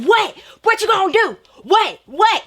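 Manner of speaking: high, loud taunting challenge; punchy mock-aggressive dare; confrontational girl. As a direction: imitate a girl spoiling for a fight. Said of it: "one of them girls, she's like".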